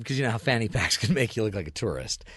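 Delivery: ordinary talk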